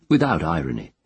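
ordinary delivery

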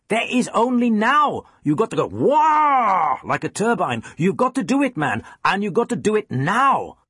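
put-on high, fervent shout in a Danish accent; Jensen, shouted. A narrator's reading. Cello teacher urging student to play now, fervent shouting. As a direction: exhort like an exorcist, pitch soaring. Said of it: "he shouted".

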